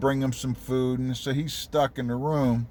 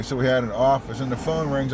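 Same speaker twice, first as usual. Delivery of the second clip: normal talking voice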